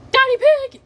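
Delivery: very high, loud cry of the name; alarmed exclamation. Retold or imitated. Mommy Pig cries out at Daddy Pig's stunt, high alarmed call.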